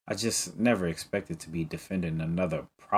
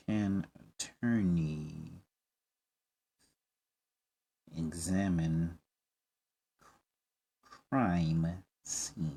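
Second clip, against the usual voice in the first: slow, musing question; 5 dB quieter, much slower than usual. Real, not acted. Player slowly musing.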